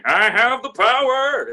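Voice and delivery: loud, high, slow heroic declaration; superhero cry. Retold or imitated. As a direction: proclaim it like a cartoon superhero. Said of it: "I was all about the superheroes".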